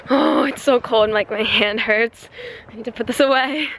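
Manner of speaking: ordinary delivery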